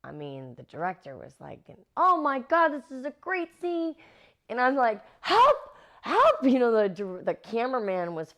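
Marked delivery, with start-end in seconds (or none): 2.0-4.0 high-pitched, gushing excitement; thrilled director. Retold or imitated. Director watching the fight scene, high excited raving. 5.2-6.4 high, loud panicked cry; begging for help. Retold or imitated. Actress thrown around on set, loud frightened pleading. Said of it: "begging for help".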